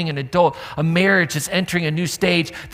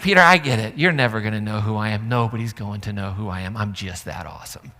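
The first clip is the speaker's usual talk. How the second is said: mock smug; 7 semitones lower than usual. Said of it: "He's like".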